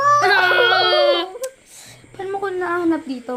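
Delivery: surprised yell on being found; startled shout. Real, not acted. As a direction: jump and cry out. (gulat na sigaw)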